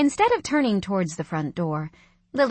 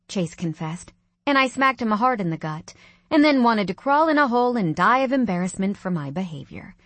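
ordinary delivery

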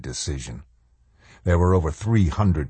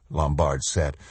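usual speaking voice

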